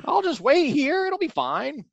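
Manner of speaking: a kindly monster who waits without worry; cheerful Baxon voice. Retold or imitated. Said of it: "he ends up falling into the pit and is like".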